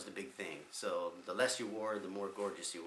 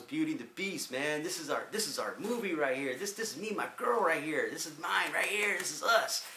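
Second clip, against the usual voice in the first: imitating Donnie; 8 semitones higher, 5 dB louder than usual. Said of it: "Donnie turns around and goes".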